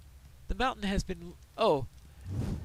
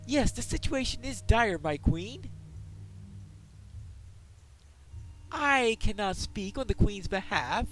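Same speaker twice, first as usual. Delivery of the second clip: a lightly raised, formal squire voice; noble squire; 6 semitones higher, 3 dB louder than usual. Acted. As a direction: speak as a dutiful young squire to his queen.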